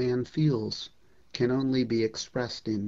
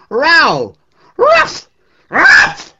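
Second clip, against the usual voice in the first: very high, very loud barking; loud imitated dog barks; 18 dB louder, much slower than usual. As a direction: bark at the crowd like an angry dog. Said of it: "barked at them like this".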